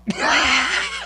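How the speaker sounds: high-pitched real laugh; burst of laughter. Real, not acted. Joke about being asked to dances.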